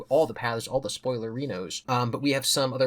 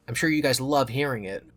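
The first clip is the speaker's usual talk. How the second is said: plain reading voice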